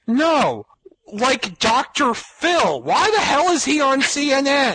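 high, incredulous outrage; can't believe this is happening; exasperated. Real, not acted. Outraged at Doctor Phil on CNN.

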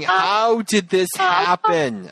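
slow, dazed how did this happen; mock bewildered Hillary. Lightly voiced Hillary, slowly asking how did this happen. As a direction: drag it out, stunned and lost. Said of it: "Hillary is standing there going".

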